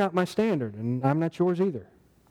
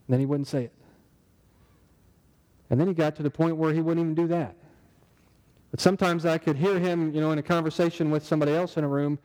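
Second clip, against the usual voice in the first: ordinary delivery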